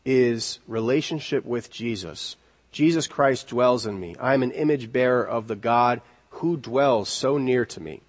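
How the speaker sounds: usual speaking voice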